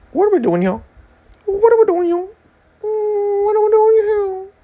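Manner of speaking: comic high-pitched wail; mock bewilderment.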